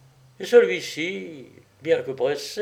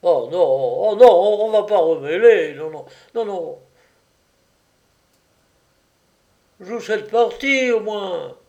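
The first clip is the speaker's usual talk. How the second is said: loud, raised chorus of objections; clamouring protest; 5 semitones higher, 7 dB louder than usual. A narrator's reading. Card players beg the painter to stay, clamouring. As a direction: cry out, then press the point higher. General outcry drowns painter's voice.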